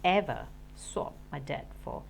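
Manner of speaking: usual speaking voice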